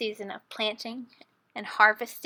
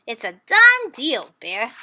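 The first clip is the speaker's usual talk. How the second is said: sly Hare; 8 dB louder, slower than usual. Acted. Hare sealing the deal.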